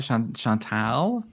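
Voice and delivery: Chantal drawn out, rising, ending near "tal"; puzzled copied pronunciation. Retold or imitated. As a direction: question Chantal slowly as "shawn... shawn-TAL?". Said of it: "say her name like that".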